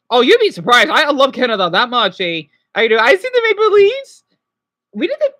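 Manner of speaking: loud, high, gushing burst of love for Canada; excited shout. Real, not acted. Streamer to chat about Canada, loud excited gushing. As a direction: shout it out like a superfan.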